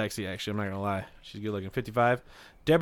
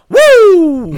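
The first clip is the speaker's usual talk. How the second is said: very high, loud whoop; signature wrestler woo; 17 dB louder than usual. Acted. Imitating a wrestler on his birthday, high whoop. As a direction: let out a piercing, drawn-out woo. Ric Flair's signature cry after his name.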